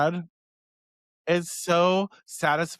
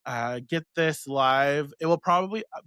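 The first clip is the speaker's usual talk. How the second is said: usual speaking voice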